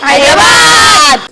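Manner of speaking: long, high-pitched yell; drawn-out shout. Real, not acted. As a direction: shout it out, stretching the word right out. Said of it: "ఎందుకు అలా అరుస్తున్నావు". (సాగదీసి గట్టిగా అరుస్తూ)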